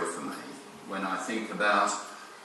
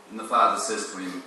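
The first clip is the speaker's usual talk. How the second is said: ordinary talk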